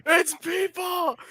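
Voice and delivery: slow, high, shouted reveal; dramatic shout. Pretend. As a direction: scream the movie line in horror. Shouting famous movie quote.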